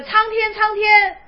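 loud, high cry to the heavens; calling to heaven. Acted. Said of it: "就会叫苍天". (高声呼喊苍天)

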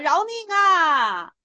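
slow, drawn-out, hammed-up cry for mercy; mock plea for mercy. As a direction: beg to be spared, stretching it out. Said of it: "法眼就说了". (拖长声音假装求饶)

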